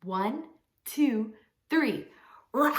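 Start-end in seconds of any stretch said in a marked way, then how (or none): 2.5-2.7 big drawn-out lion roar. Pretend. Leading children on the count of three, big lion roar. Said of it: "with that loud roar".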